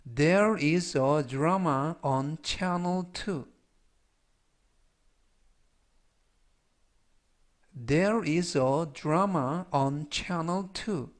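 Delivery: very slow, word by word English; slow model reading. Shown as a demonstration. Teacher repeating a sentence slowly for students to copy. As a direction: spell it out in sound, slowly.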